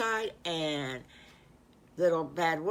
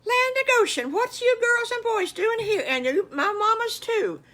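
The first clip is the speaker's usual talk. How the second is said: much higher, louder astonished cry; surprised exclamation; 7 dB louder than usual. A narrator's reading. Mrs Farmer Brown astonished by the kids, high and loud. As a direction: gasp out the surprise.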